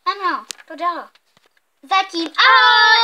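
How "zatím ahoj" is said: high, loud cheerful sign-off; raised, drawn-out farewell; shouted goodbye. Real, not acted. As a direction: call out farewell to the viewers. Signing off the video loudly.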